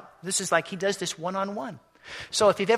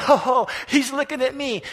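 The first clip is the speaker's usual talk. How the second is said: high, louder delighted gasp of wonder; amazed saint; 7 semitones higher, 6 dB louder than usual. Acted. A saint in heaven realizing Jesus looks at them, high and amazed. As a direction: marvel that he sees only you. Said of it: "It's like".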